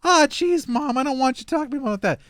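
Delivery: put-on loud, high-pitched whining kid; embarrassed son. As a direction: protest to your bragging mom. Acting embarrassed son to his mom.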